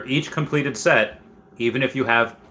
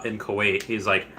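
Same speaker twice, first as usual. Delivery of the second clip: ordinary talk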